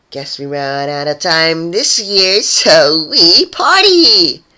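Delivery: high, slow, swelling to a loud sing-song cheer; gleeful cheer. A narrator's reading. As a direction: gloat and build to a party cry. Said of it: "Surprise giggled loudly".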